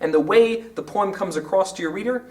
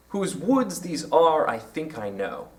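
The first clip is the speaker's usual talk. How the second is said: "Whose woods these are" slow, stressing woods and are, then softer; iambic verse reading; 2 semitones lower, 4 dB quieter than usual. Shown as a demonstration. Teacher reading Frost so iambs show, measured stress on "woods" and "are". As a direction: recite with the beat on "woods", "are", "think", "know". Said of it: "Can you hear the iambs there?".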